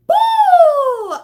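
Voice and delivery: high loud celebratory woo; excited whoop. Real, not acted. Excited about the song release.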